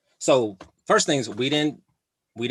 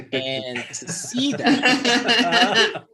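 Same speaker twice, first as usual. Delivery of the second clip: laughing; 10 semitones higher, 4 dB louder, much slower than usual. Real, not acted. Laughing while telling birth story.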